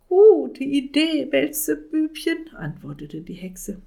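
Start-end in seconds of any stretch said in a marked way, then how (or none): 0.1-2.5 high, loud witch voice. Acted. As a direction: cackle it out like a witch. Witch answers the wizard.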